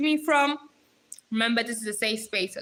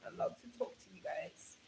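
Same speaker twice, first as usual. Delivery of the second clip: whispered, much softer; 3 semitones lower, 17 dB quieter than usual. Real, not acted. Streamer telling viewers she loves talking, whispered. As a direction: breathe it out almost voiceless.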